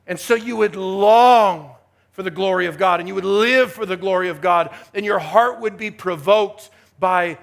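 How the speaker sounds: fervent preacher hammering key words; impassioned preaching. Real, not acted. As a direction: drive it home to the congregation with swelling force. Preaches at full force.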